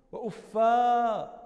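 وأفا as "uffaaa", loud, stretched final a; long final alif. Shown as a demonstration. Teacher showing the form like hubla, loud drawn-out "uffaaa" in وأفا. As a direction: hold the last vowel of وأفا long and loud. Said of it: "كحبلى".

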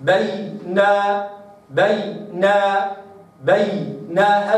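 بينهم drawn out slowly, ya held clearly; slow recitation. Shown as a demonstration. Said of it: "هكذا". (بينهم ببطء مع إظهار الياء)

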